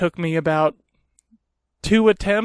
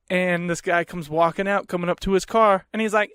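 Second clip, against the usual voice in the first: ordinary delivery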